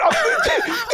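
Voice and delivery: bright, rising laugh; high laugh. Real, not acted. Telling friends about the film, high laugh. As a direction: let the laugh spill out.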